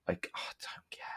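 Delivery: whispered aside. Real, not acted. Dismissive aside mid review.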